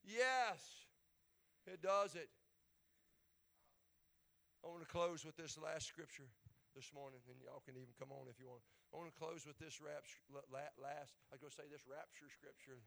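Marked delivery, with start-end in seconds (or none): 4.6-12.8 soft, fast murmured aside; quiet aside. Real, not acted. Pastor muttering a slip before the last scripture, quiet. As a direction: drop out of the sermon, half to yourself. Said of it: "quiet aside before closing".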